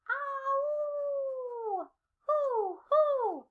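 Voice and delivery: high hooting owl call; playful high owl hoots. Acted. Matching sounds to animals.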